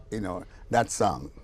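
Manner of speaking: ordinary talk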